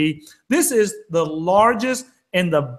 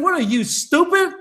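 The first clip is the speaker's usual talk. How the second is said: worked-up retort; 7 semitones higher than usual. Retold or imitated. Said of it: "I know I'm getting emotional here".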